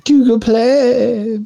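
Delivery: slow, high, stretched "Google Play"; drawn-out high. Pretend. Echoing slowly, high.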